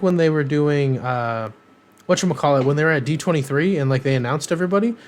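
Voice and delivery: ordinary delivery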